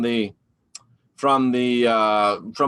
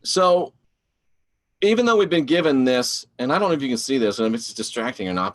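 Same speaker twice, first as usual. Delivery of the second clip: ordinary talk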